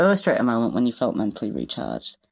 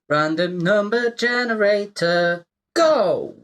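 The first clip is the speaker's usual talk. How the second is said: game show host; 8 semitones higher, slower than usual. Pretend. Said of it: "announcing the generator like a host".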